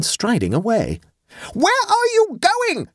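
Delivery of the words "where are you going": shrieked question, very high and drawn out; high, slow, outraged shriek; screamed. A narrator's reading. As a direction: scream it at him as he walks off. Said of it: "screamed Adela".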